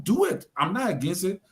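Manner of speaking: normal talking voice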